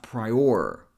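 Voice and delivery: PRIOR drawn out slowly, weight on the OR; stress on OR. Shown as a demonstration. Teacher showing the parts so far, "prior" stressed on OR. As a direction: say "prior" as pry-OR, second syllable stressed. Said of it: "Put some emphasis here".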